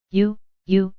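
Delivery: halting, stunned repetition; thrown off balance. A narrator's reading. Colonel facing the figure with the silver, thrown off balance. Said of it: "quite thrown off his balance at last".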